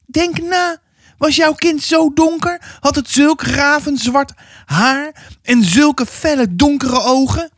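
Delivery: put-on high, pressing old woman's voice; stern old neighbour. Old neighbour warning the mother about her child, high and pressing. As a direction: lean in like a suspicious village gossip.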